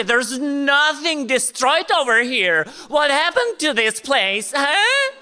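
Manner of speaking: a brash visitor demanding to see ruins; American journalist. Retold or imitated. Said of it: "she came up to me like".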